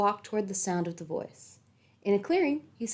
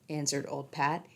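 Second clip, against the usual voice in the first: plain reading voice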